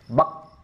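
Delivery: "Bak" with a firmly pressed final q; syiddah, tekanan kuat. Shown as a demonstration. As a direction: press hard into the end of "Bak". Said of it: "Artinya kuat tekanannya". ("Bak" dengan tekanan kuat di akhir)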